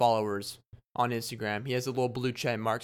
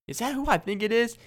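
excited question; 10 semitones higher, 3 dB louder than usual. Retold or imitated. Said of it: "I was like".

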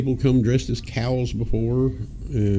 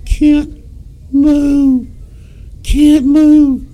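very high, strained terrified squeak; scared stiff; 13 semitones higher, 7 dB louder, much slower than usual. Retold or imitated. Scared friend frozen in the graveyard, high terrified squeak. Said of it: "He's like".

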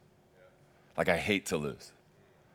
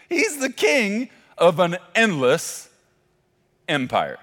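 slow, higher and louder, leaning on He's; slow emphatic repeat; 7 semitones higher, 7 dB louder, slower than usual. Shown as a demonstration. Preacher repeating his one-liner to the church, slow and emphatic. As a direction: drive the line home again, slower and bigger. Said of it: "Here's a one-liner for you".

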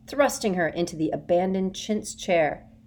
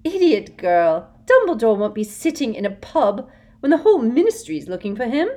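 angry shout; 6 semitones higher, 11 dB louder than usual. A narrator's reading. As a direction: snap in disappointment. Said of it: "shouted Umbridge".

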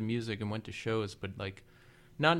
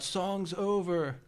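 drawn-out mock heckle, slightly raised pitch; retold yell; 4 semitones higher, much slower than usual. Drunk merch seller heckling the screaming singer, drawn-out mock yell. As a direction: imitate a drunken call-out without full volume. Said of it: "then I yelled out".

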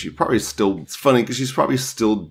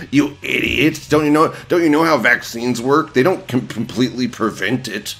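higher, louder, condescending sneer; sarcastic mock voice; 5 semitones higher, 4 dB louder than usual. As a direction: scold them like a smug know-it-all. Sarcastic leftist voice.

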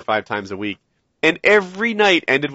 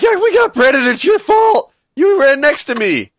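high, loud blaming yell; mock accusing rant; 12 semitones higher, 7 dB louder than usual. Imitating a friend blaming him for the Predator hit, angry shout.

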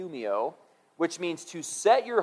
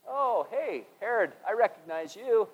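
light mock greeting, pitched up high; playful Jesus; 5 semitones higher, 4 dB quieter than usual. Jesus teasing a Pharisee, high and playful. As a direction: greet him like an old acquaintance. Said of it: "and he goes".

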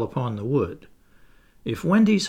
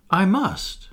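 shaking; 7 semitones higher than usual. A narrator's reading. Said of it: "he answered, shaking".

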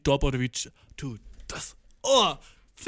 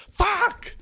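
shocked outburst; 5 dB louder than usual. Real, not acted. Sudden shock at own mistake.

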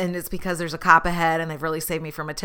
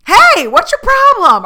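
high, loud mock-angry snap; 14 dB louder than usual. Snapping at a rude driver: loud high mock anger. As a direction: bark it at a stranger, hammed up.

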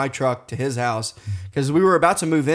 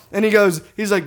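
ordinary delivery

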